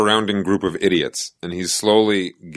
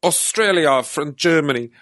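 mocking a bad Australian accent that slips into German, higher; high, mocking Australian accent turning German; 5 semitones higher, slower than usual. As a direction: tease him with an Australian accent that slides into German. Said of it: "You always slide out into a different accent".

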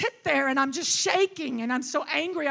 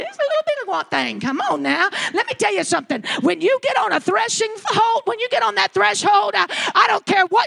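loud, high, shouting sermon climax; full-force preaching; 8 semitones higher, 13 dB louder than usual. Acted. Preacher exhorts the congregation, full-force preaching. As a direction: drive it home to the congregation.

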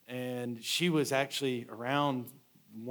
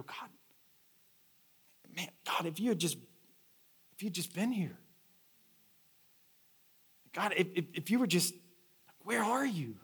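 pleading with God; 4 semitones higher, 4 dB quieter than usual. Acted. Acted desperate questioning of God.

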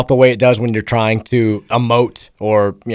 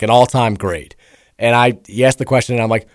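ordinary talk

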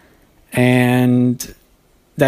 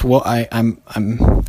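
ordinary talk, nothing marked

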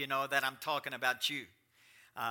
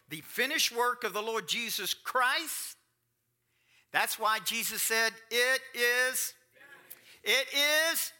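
climactic preaching; 10 semitones higher, 8 dB louder than usual. Acted. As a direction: lift the church to the peak.

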